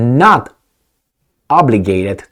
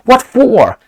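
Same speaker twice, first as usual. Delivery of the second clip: put-on "What for?" in a higher, louder try at an Australian accent; Australian accent attempt; 9 semitones higher, 3 dB louder than usual. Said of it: "I will try to pronounce it".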